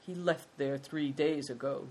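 ordinary delivery

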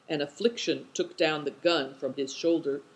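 ordinary delivery, nothing marked

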